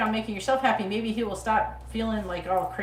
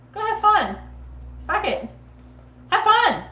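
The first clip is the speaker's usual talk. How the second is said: much higher, loud cheer of go have fun; emphatic urging; 11 semitones higher, 6 dB louder than usual. Real, not acted. Tarot reader urges the client to go have fun, loud and high. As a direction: shout her into enjoying life.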